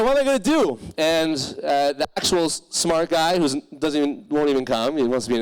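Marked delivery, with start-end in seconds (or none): 0.0-0.8 panicked question. Retold or imitated. Panicked quote to himself.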